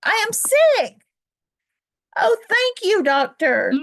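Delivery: high, loud, delighted thanks; overjoyed storybook elephant; joyful relief. Acted. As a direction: burst with happy relief. Gerald delighted it is only a cold.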